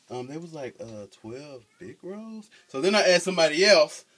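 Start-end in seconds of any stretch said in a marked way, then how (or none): 0.8-2.5 soft, slow, unsure reading with a rising question; a hesitant stranger squinting at the sign; puzzled bystander. Retold or imitated. Bystander asked to read the sign, soft unsure mumble.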